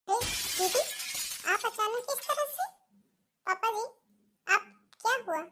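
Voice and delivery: taken aback by an unexpected arrival; acted surprise. Daughter-in-law greeting sister-in-law's sudden arrival, surprised.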